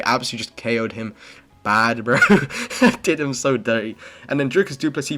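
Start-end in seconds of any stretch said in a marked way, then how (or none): 2.2-3.0 short high cackle at a knockout; burst of high laughter. Real, not acted. Laughing at a fighter getting knocked out, a loud high cackle.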